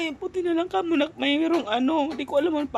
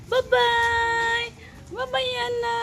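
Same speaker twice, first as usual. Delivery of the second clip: singsong goodbye; 10 semitones higher, 3 dB louder, much slower than usual. Real, not acted. Waving goodbye to baby.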